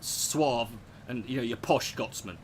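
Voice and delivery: slow, posh Edinburgh Scots; a suave Edinburgh gent, unhurried; lightly done posh Edinburgh Scots voice; Edinburgh accent. Shown as a demonstration. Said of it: "Edinburgh is".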